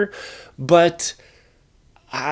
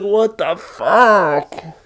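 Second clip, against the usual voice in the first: drawn-out, higher-pitched mock outrage; exasperated disbelief; 5 semitones higher, 4 dB louder, much slower than usual. Reviewer retelling reaction to the robot's stupid joke, exasperated disbelief. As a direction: stretch every word out in retold disbelief.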